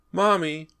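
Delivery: put-on high, loud child's cry; a lost boy crying out from the dark; crying little boy. A narrator's reading. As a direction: call out for mommy, desperate and scared. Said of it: "the voice cried".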